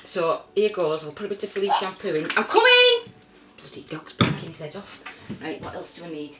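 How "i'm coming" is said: loud high call across the house; sudden loud call. Real, not acted. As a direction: shout it out to the next room. Calling out to someone.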